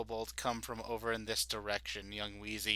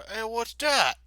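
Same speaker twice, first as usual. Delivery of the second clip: high, loud guard calling out; a guard who just spotted something odd; startled guard; 12 semitones higher, 8 dB louder, slower than usual. Acted. GM voicing a guard who spots a light.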